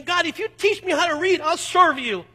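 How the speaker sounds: put-on young man crying out to God in desperation; desperate plea. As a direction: beg God like your life depends on it. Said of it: "I cry out of desperation".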